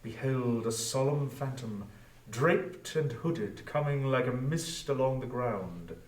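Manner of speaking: ordinary delivery, nothing marked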